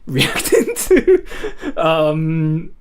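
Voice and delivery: high laughing, slowing down. Real, not acted. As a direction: dissolve into laughter. Breaks into laughter.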